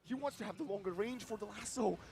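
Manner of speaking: excited play-by-play. Acted. Excited live casting.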